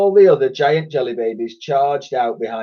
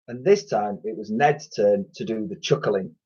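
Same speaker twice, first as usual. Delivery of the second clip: plain reading voice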